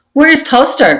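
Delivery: high, loud impatient cry; cried out. A narrator's reading. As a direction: shout up at the leaders. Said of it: "cried one".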